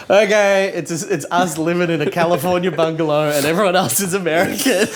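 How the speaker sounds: high-pitched, laughing concession; mock giving in. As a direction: give in comically, pitch well up. Acted giving in on the casting.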